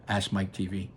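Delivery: ordinary delivery, nothing marked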